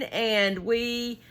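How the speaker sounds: ordinary delivery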